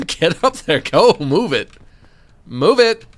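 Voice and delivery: loud rising urging; urgent shout. Real, not acted. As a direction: yell at it to move. Urging character up the wall.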